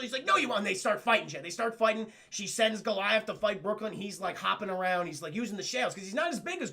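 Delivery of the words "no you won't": high mock-defiant comeback; defiant retort. Brooklyn answering Demona's threat, defiant. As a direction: fire it back high. Acted quarrel.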